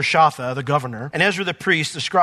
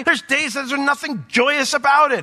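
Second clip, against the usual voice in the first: ordinary talk, nothing marked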